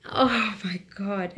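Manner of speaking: slow, breathy through laughter; laughing. Real, not acted. Recalling her mother's reaction, laughing through the words. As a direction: still laughing at the memory.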